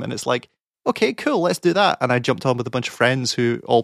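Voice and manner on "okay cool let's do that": quoting an eager, high-pitched yes; chirpy, much higher retold reply; upbeat agreement. Player agreeing to join friends, upbeat.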